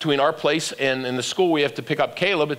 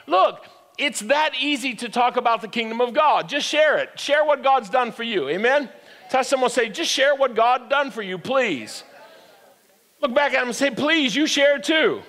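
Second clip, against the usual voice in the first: preaching at full force; 5 semitones higher than usual. Acted. Pastor rallying the congregation, fired-up preaching. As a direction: rouse the congregation.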